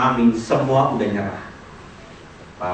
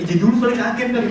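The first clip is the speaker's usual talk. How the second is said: startled mother; 11 semitones higher, faster than usual. Retold or imitated. Mother startled by hug request.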